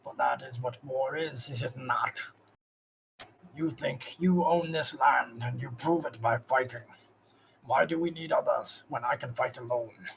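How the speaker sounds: pained orc master. Acted. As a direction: force out orc ways as if it hurts. Zog philosophises on war.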